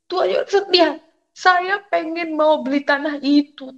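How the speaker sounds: high, insistent appeal to God; implore, as if through tears; retold tearful plea. Mother asks God for the land, retold tearful plea. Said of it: "Saya menangis di belakang kosan".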